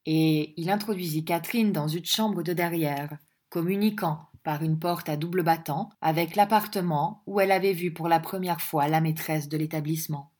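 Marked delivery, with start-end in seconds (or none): none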